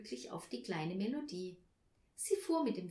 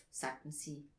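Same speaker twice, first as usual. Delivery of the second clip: ordinary delivery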